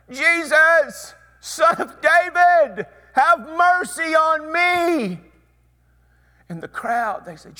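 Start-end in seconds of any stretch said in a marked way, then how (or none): 0.1-5.2 high, loud, slow desperate plea; crying out. Retold or imitated. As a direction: call out over a crowd, begging. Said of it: "he just begins to cry out".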